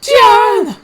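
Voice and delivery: very high, very loud call; shout. A narrator's reading. Luan and Hellas shout to John. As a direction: bellow his name. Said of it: "shouted Luan and Hellas".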